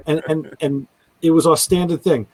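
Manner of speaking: ordinary talk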